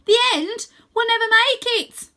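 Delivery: very high, loud hopeless cry; boy shouting his doubt to Rose; despairing shout. Acted. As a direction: cry out that it's impossible.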